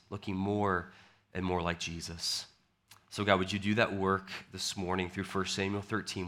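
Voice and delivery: soft, hushed pastoral prayer. Acted. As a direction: pray quietly before the sermon. Opens with a prayer.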